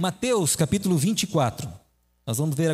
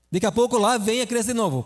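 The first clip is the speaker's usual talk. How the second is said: ordinary talk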